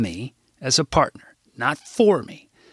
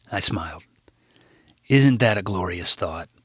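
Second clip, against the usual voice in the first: ordinary delivery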